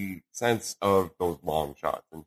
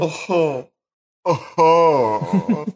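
laughing; 7 semitones higher, 9 dB louder, much slower than usual. Real, not acted. Laughing fit after bit.

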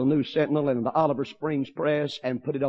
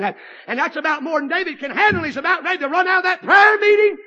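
rising, shouted preaching; preaching at full force; 12 semitones higher, 11 dB louder than usual. Real, not acted. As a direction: build it up into a loud shout.

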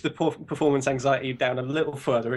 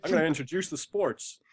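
put-on American anchor voice, slightly Canadian; American newscaster accent; 4 semitones higher than usual. Shown as a demonstration. British guest continuing his demo, American newscaster accent. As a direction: hand over like an American newscaster. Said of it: "little more Canadian".

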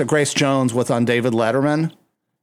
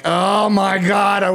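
exasperated outburst; 6 semitones higher, much slower than usual. Retold or imitated. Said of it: "you're like".

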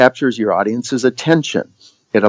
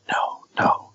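very soft, near whisper; almost whispering; 11 dB quieter than usual. Shown as a demonstration. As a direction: barely voice it, very softly. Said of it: "almost whispering".

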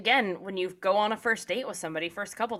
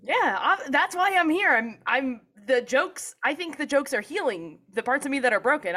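higher, louder hammed-up confession; mock patient; 7 semitones higher, 4 dB louder than usual. Patient answering the therapist, hammed-up and higher. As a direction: play the joking patient defending their jokes.

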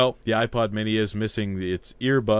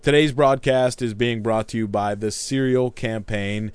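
normal talking voice

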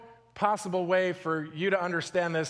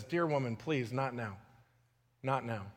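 soft, gentle plea; softly beg, not now; tender; 6 semitones lower, 7 dB quieter than usual. Retold or imitated. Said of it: "and he says".

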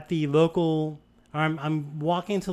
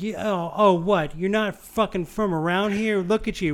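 high, loud sneering yell; drunk woman yelling; 5 semitones higher, 3 dB louder than usual. Retold or imitated. Drunk woman jeering at the driver to go back home, loud and high. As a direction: spit it out like a mean drunk. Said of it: "she's like".

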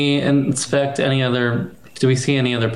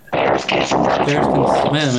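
much lower, slow deep bear voice; 12 semitones lower, much slower than usual. Pretend. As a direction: drop it low and slow like a bear. Mock deep bear line.

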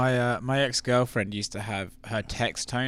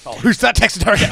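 startled; 11 semitones higher, 12 dB louder than usual. Real, not acted. Phone noise startles, exclaims.

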